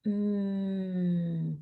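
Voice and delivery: "mm" held long with closed lips; stretched m. Shown as a demonstration. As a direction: hum "mm" without opening the mouth. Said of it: "The M sound is".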